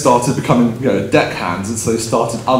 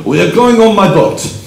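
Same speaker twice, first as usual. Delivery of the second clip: hammed-up French accent, higher and louder; 8 semitones higher, 5 dB louder than usual. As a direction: imitate a French boat owner, loud and emphatic. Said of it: "he said in an accent".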